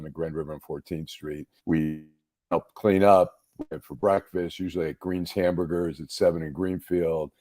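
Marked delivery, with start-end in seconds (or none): none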